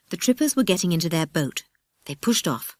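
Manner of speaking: plain reading voice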